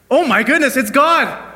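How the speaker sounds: high, loud gasp-like exclamation; astonished cry. Acted. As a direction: blurt it out in stunned disbelief. Atheist stunned hearing God's voice.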